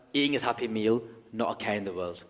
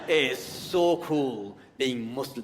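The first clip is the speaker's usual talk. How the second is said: savoured; 2 semitones higher, 3 dB louder, slower than usual. Real, not acted. Slow savoured declaration.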